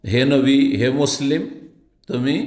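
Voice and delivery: ordinary delivery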